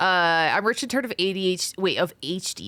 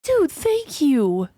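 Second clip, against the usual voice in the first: excited thanks; 13 semitones higher, 3 dB louder, slower than usual. Real, not acted. Thanking subscribers on stream.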